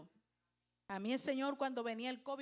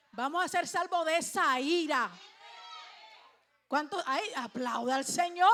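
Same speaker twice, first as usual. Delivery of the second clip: loud, rousing preacher's proclamation; emphatic pulpit shout; preaching at full force; 3 semitones higher, 8 dB louder than usual. Real, not acted. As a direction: ring it out to rally the congregation. Rallies the congregation in preaching.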